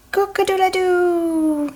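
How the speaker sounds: high crowing rooster voice. Acted. Rooster waking everyone up, high crow. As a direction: crow to wake the whole farm.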